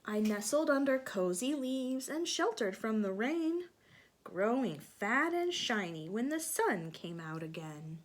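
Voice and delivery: animated storybook reading; reading to children. A narrator's reading. Teacher reading aloud to children. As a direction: read it lively for little ones.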